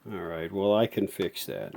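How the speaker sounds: usual speaking voice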